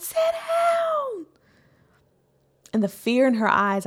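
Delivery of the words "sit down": high, sharp mock shout at a child; retold angry yell. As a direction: snap it out like a tired mom losing it. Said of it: "I yelled".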